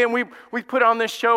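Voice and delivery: normal talking voice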